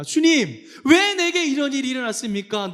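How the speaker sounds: high, loud accusing plea; confronting God. Acted. People arguing with the Lord, loud indignant protest. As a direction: call out and demand an explanation. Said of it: "따지는 겁니다".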